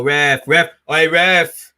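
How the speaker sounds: loud call to the referee; shouting at the ref. Real, not acted. Fan shouting at the referee for a foul. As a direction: bark it at the ref.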